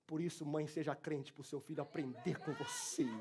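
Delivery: soft, low, intimate sermon voice; hushed preaching. Acted. Quiet reflective preaching.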